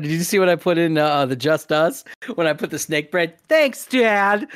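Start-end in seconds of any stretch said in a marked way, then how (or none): 3.5-4.5 a higher, louder put-on kid voice; mock thanks. Mimicking the snake bread reaction, playful high kid voice. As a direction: chirp it like a cheeky kid.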